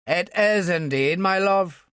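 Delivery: put-on Irish accent of his childhood, a bit slow; Ulster accent. A narrator's reading. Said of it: "He slipped back into the accent of his childhood".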